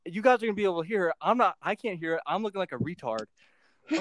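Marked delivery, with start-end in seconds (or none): none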